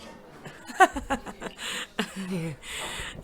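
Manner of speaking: a breathy embarrassed laugh; flustered laugh. Acted. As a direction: laugh it off, caught off guard. Flustered after hand kiss.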